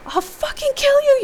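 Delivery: high, loud screamed threat; enraged scream. A narrator's reading. Hero screaming at Rick while fighting for knife, enraged. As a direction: scream it with full fury. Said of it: "you scream at him again".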